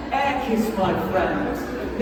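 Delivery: ordinary delivery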